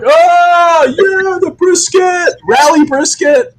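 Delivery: very high, slow rally shout; rally the crowd hard; hype shout. Pretend. S1 imagining brisket hurled into crowd, high shout. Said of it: "like Blue Man Group".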